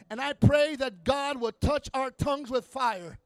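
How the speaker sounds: loud, rising preacher's cry; preaching at full force. Acted. Pastor praying over the church, loud preaching. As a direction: preach it out to the congregation.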